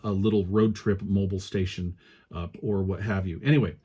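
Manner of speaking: normal talking voice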